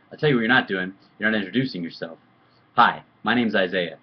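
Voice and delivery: loud, emphatic telling-off; imitated forceful man-to-kid instruction; retold stern lecture. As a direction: act out scolding a kid on manners. Acted lecture on introducing oneself.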